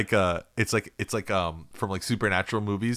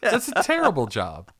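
mock outrage; 10 semitones higher, 4 dB louder than usual.

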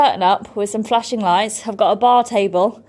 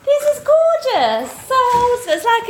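excited; 11 semitones higher than usual. Real, not acted. First sight of dressing room.

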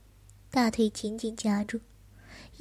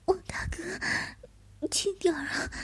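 high, soft, coy plea; coy pleading; 7 semitones higher, 5 dB quieter than usual. Acted. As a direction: plead softly and coyly. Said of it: "娇吟一声".

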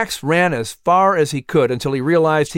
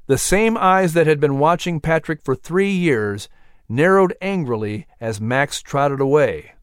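ordinary delivery

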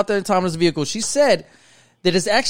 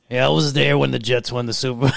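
put-on old man voice; 4 semitones lower than usual. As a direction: boast like an old man, shouting. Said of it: "like, an old man voice".

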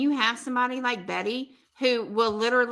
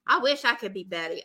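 normal talking voice

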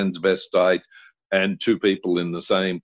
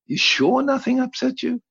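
much higher, incredulous probe; disbelieving. Retold or imitated. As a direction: squeak out the doubt. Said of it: "And I went".

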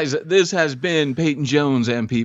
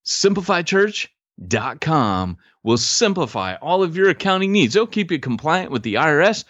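sultry ad read; 10 semitones higher than usual. Acted.

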